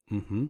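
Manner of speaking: MM-HMM dipping, then bouncing up; bouncing third tone. Shown as a demonstration. Teacher giving the nearest English sound, dip and bounce. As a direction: hum MM-HMM low, then lift it. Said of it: "called the bouncing tone".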